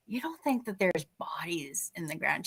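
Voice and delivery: spooked stage whisper, acted out; retold whispered question to a confidante; hushed question. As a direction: whisper it as a dreadful suspicion.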